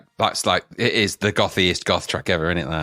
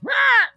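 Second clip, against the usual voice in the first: very high, loud mock squawk; trodden-on chicken squawk; 5 dB louder than usual. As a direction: yelp like a chicken underfoot. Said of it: "stepping on the, on the back of a chicken".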